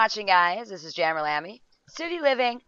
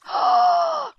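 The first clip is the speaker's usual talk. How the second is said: a sudden high gasp of alarm; sharp high gasp; 6 dB louder than usual. Real, not acted. Gasping at the screen as the kid nearly wets himself. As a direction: gasp in real shock. Watching game character panic.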